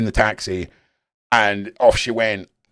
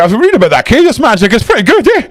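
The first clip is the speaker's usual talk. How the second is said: excited mate; 12 semitones higher, 10 dB louder, faster than usual. Retold or imitated. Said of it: "he just came out that week and said".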